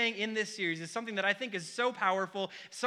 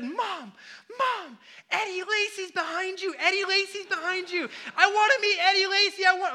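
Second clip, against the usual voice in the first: put-on much higher and louder, excited little boy yelling; a small child shouting for his mom in the store; toddler screaming; 10 semitones higher, 5 dB louder than usual. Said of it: "just starts screaming".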